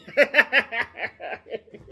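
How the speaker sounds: loud, high cackle; real laugh. Real, not acted. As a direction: laugh hard at your own teasing. Laughs after teasing his buddy.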